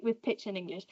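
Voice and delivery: usual speaking voice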